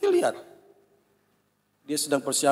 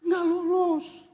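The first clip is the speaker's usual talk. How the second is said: bewildered; much slower than usual. Real, not acted. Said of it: "Karena saya bingung".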